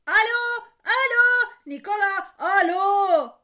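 high, very loud calling into a phone; insistent bellowed hellos; shouting. A narrator's reading. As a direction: keep yelling down the line. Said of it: "diye bağırmaya devam etti".

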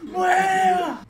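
high, drawn-out party yell; rowdy shouting. Retold or imitated. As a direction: holler it long and high over a club crowd. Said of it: "a los gritos, gritando". (gritando a los gritos, agudo y alargado)

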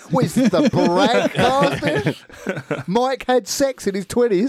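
high, slow, laughing mock disbelief; laughing tease. Real, not acted. Teasing a friend about bragging, laughing. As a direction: drag it out through a laugh.

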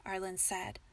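usual speaking voice

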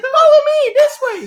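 loud, squealing mock female falsetto; Ian's drunk voice. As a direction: lead the charge in a hammed-up, very high drunk voice. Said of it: "He's still talking like that".

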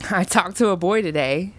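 upbeat and pleased; cheerful. A narrator's reading. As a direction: share happy news brightly. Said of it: "Sam cheerfully answered".

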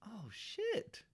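high, soft startled gasp of realization; sudden realization. Retold or imitated. Retelling spotting Cena's finisher jab, surprised. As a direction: catch it all at once, hushed. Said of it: "I was like".

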